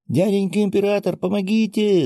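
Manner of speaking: put-on high-pitched little child calling for help; ringing child's cry. A narrator's reading. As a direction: call out like a small child, voice way up. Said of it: "донёсся до меня звонкий голосок". (звонкий детский голосок)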